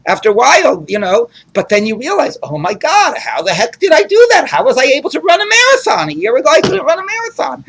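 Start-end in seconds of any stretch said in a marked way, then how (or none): 2.4-7.6 higher, mock astonished exclamation; amazed. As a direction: marvel at yourself, wide-eyed. Runner amazed at own progress.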